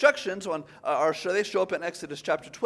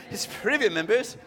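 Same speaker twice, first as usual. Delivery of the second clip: higher, hammed-up British accent; 4 semitones higher than usual. Shown as a demonstration. Preacher replaying a quoted phrase for fun, mock British accent. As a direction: put on a bad British accent. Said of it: "say that in a British accent".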